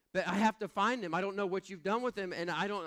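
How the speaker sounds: mock flustered wife; frustrated wife. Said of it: "She responded".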